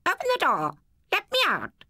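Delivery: high, screechy parrot voice; squawking parrot. Acted. Parrot begging to be let out, high squawk. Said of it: "a parrot in a big cage who chattered".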